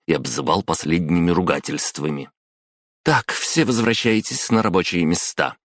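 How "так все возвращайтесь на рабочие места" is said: higher-pitched, firm instruction; mildly raised directive; brisk boss's order. A narrator's reading. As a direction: send staff back with authority. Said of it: "распорядилась Кимура Кото".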